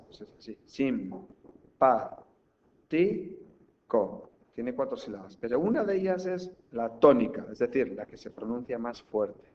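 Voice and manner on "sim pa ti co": Sim, pa, ti, co very slowly with gaps; four separate syllables, sim-pa-ti-co; split into syllables. Shown as a demonstration. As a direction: spell out sim-pa-ti-co beat by beat. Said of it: "separamos la palabra simpático en sílabas".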